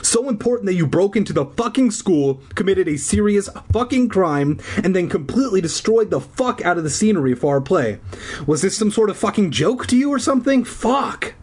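hammed-up furious teacher tirade; ranting teacher. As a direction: tear into the kids at full speed.

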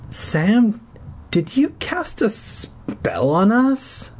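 put-on high, slow, eerie girlish voice; sick girl's question. Sick girl asking Sam, slow and high. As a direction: ask it slowly like a ghostly child. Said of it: "Judith asked".

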